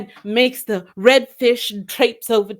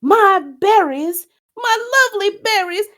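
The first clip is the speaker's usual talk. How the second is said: hammed-up wail; 12 semitones higher than usual. Redfish wailing over berries.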